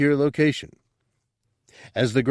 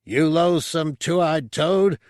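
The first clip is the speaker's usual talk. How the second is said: slow, loud, higher snarl at the toad; furious insult; 7 semitones higher, 4 dB louder, slower than usual. Acted. Drunken Reis rages at Bubo, loud and slow. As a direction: spit it out slowly, losing it. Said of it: "Reis was losing it again".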